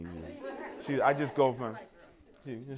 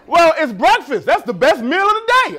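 higher, louder pushy insistence; eager insistence; 8 semitones higher, 8 dB louder than usual. Retold or imitated. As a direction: insist it's the best meal. Bob insists on breakfast.

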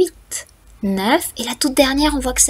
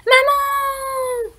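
high frightened call for mum; scared little boy calling high; child crying out. A narrator's reading. A frightened boy calls out for his mum. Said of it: "Il crie".

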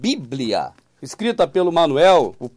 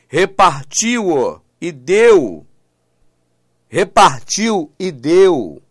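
"repartiu" and "deu" drawn out very slowly, each punched; stress on key verbs; 4 dB louder, much slower than usual. Shown as a demonstration. Preacher drives home the verse's verbs, slow emphatic stress. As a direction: stretch "repartiu" and "deu", landing hard on each.

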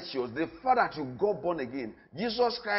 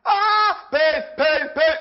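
mock cry of pain; 8 semitones higher, 7 dB louder, much slower than usual. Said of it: "because you want to scream".

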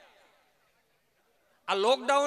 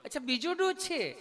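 higher, sweet polite nurse; nurse's voice; 5 semitones higher than usual. Retold or imitated. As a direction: gently quiz the patient. (નર્સનો ઊંચો, મીઠો અવાજ)

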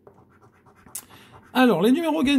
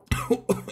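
sudden coughing fit; choking cough; 3 semitones higher, 4 dB quieter than usual. Real, not acted. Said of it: "je m'étouffe".

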